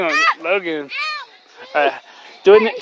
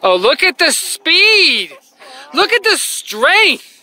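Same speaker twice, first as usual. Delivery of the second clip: excited; 9 semitones higher, 6 dB louder, much slower than usual. Real, not acted. Dad hyping daughter pushing ride.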